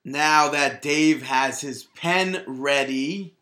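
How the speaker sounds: slow, deliberate show-host announcement; announcer read. Acted. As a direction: announce the card like a broadcast host.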